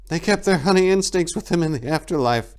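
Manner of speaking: put-on high, anxious young monk; a young monk's rising alarm; rising panic. A narrator's reading. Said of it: "panic rising in his voice".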